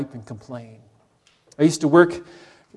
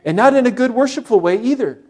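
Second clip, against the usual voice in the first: grumbling complaint; 6 semitones higher, 4 dB louder than usual. Retold or imitated. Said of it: "that is actually what grumbling is".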